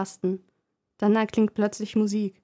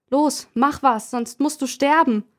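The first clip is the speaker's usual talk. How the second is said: menacing threat; 5 semitones higher, 4 dB louder than usual. Acted. Leonie droht der Puppe.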